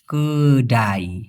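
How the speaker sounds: কেডাই stretched very slowly, low; slow teaching pronunciation of কেডাই; drawn-out Malay word. Shown as a demonstration. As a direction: linger on কেডাই syllable by syllable. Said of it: "তুয়ান কেডাই".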